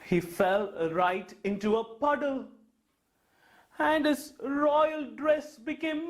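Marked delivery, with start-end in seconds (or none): none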